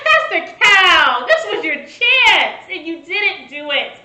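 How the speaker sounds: loud, slow call of dismay; retold exasperated plea. Viewer pleading with Pastor Cal over his missed chance, loud drawn-out dismay. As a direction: call out to him, stretching the words.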